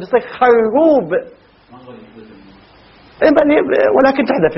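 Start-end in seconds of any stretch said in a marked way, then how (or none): 0.2-1.1 خروب slowly, with the ra doubled and a fatha on the kha. Shown as a demonstration. Teacher slowly spelling out خروب for students, drawn out. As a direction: draw out خروب as xarrub, holding the double r. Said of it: "لكن الراء تشدد".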